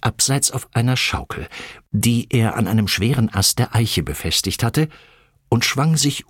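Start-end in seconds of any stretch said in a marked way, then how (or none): none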